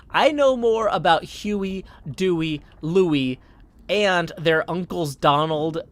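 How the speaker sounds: a fan insulted and getting heated; worked up. Real, not acted. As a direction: defend your duck cred, all fired up. Indignant defence of his duck knowledge.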